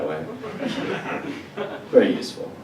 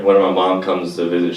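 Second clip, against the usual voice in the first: normal talking voice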